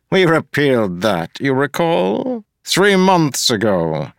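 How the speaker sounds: a pompous official looking down on everyone; smug mayor. Acted. Mayor Gave demanding an apology from Wax, sneering.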